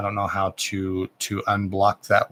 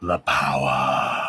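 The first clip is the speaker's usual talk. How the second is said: much lower and slow, a mock booming oracle; 10 semitones lower, much slower than usual. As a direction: drop into a slow, deep joke voice. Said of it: "Just kidding".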